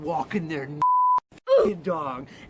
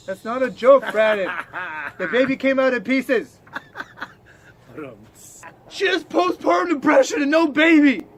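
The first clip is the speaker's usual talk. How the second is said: loud, higher, rising insistence; shouted dark joke; shouting punchline; 6 semitones higher, 8 dB louder than usual. Real, not acted. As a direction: yell the punchline at him.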